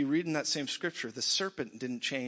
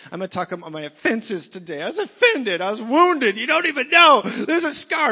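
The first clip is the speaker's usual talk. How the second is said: high, loud mock wail of wounded pride; wailing over old wounds, much higher and louder; whiny offended complainer; 8 semitones higher, 14 dB louder than usual. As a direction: ham up a self-pitying sufferer. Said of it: "people literally lined up and said".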